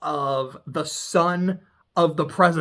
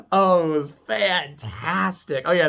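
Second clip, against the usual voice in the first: ordinary delivery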